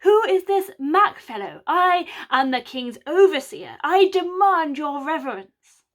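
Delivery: put-on high, loud, pompous southern English accent; overblown southern English accent. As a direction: ham up a posh southern English accent as a fake overseer. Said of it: "Mac's southern accent is so overblown".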